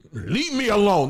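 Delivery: hammed-up cry to be left alone; mock yell. Believer waved off with books, mock shouting.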